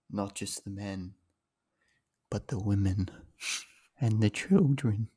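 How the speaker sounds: film-quote voice. Acted. Co-host reading meme award nominations in The Voice, slow and brooding. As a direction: let each phrase fall slowly, softly at first. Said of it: "Do it in The Voice".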